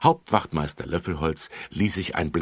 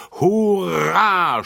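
high, loud hooray; cheering; 14 semitones higher, 10 dB louder than usual. A narrator's reading. The three children cheer before the ride, loud. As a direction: cheer with joy. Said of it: "Die drei Kinder riefen".

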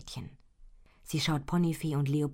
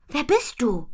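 high, loud, frightened question; startled girl, voice jumping up; 10 semitones higher, 8 dB louder than usual. A narrator's reading. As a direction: ask it as if caught by surprise. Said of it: "fragt sie erschrocken".

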